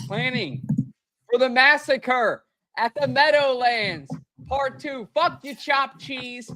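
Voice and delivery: rally the fans against New York; hype intro. Acted. Podcast intro hype read.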